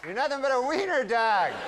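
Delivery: startled sleeper scolding his dog, high-pitched and indignant. Acted. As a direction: shout down at the wiener dog, high and scornful. Man yelling at nibbling wiener dog.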